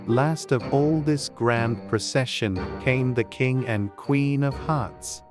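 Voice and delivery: plain reading voice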